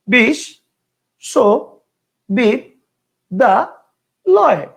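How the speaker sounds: বিশ, সো, বি, দা, লয় with a clear pause after each; five separate syllables. Shown as a demonstration. Teacher shows students one person's pronunciation, five separate syllables: বিশ, সো, বি, দা, লয়. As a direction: break the word into five isolated beats. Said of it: "বিশ্ববিদ্যালয় কেউ উচ্চারণ করতো".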